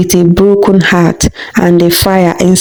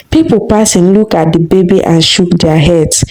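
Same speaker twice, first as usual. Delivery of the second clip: ordinary delivery, nothing marked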